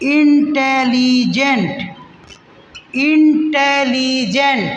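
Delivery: इंटेलिजेंट slow, each beat apart; split into syllables. Shown as a demonstration. Teacher counts the beats of इंटेलिजेंट for students, slow. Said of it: "कितना बार में बोल रहे हैं?".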